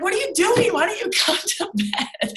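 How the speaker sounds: high-pitched mock-bewildered husband, breaking into laughter; exasperated husband. Husband finds wife on the couch at morning, mock exasperation. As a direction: imitate a baffled husband, pitched well up and laughing.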